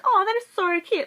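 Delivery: high-pitched cooing; gushing approval. Retold or imitated. Potter readers reacting to her story, imitated gushing. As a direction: coo over it like a charmed listener.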